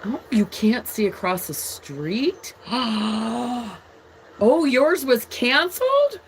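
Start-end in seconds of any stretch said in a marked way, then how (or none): 2.6-3.8 a quick surprised intake of breath; sudden startled gasp; sharp gasp. Real, not acted. Hearing a flight was canceled. 4.4-6.2 higher and louder, shocked question; startled concern at the news; surprised. Real, not acted. Viewer's flight was canceled.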